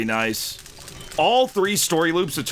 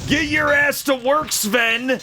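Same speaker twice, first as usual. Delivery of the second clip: high, hammed-up foreman's yell; mock bossy yell; 11 semitones higher, slower than usual. Streamer chivvying Sven in the game, mock bossy yell. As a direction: yell at a slacking worker in jest. Ordering game character to work.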